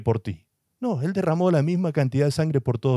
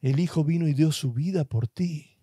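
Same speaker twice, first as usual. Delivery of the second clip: ordinary delivery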